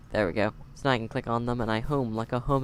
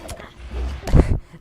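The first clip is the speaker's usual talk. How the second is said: burst of laughter; 10 dB louder than usual. Real, not acted. Laughing at his own reaction.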